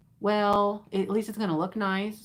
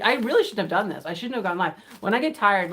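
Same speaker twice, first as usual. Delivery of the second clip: usual speaking voice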